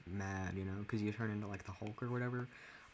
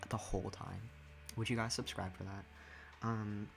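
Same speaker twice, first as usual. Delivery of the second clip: normal talking voice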